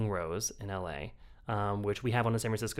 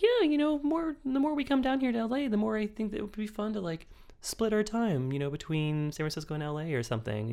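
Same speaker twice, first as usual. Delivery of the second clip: high, upbeat husband voice; imitating husband; 11 semitones higher than usual. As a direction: play the sweet, hopeful husband. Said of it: "he's like".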